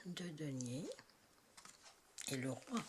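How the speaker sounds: murmuré dans un souffle, presque sans voix; chuchoté. Real, not acted. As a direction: souffle les mots comme un secret. Reading card names softly. (nom de carte chuchoté en lisant)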